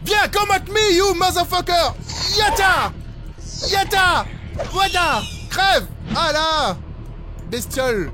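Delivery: high, loud fighting yells; battle shouting. Real, not acted. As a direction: scream at the monster while fighting. Fighting monster, shouting insults.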